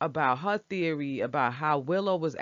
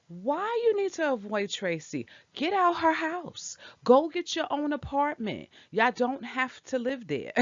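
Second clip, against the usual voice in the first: exasperated; 7 semitones higher than usual. Real, not acted. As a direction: plead with them in a higher, incredulous voice. Fed up with story's plan.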